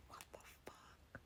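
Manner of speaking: barely audible, voiceless mouthing; almost silent stunned aside; shocked whisper. Retold or imitated. Retold shocked aside to herself.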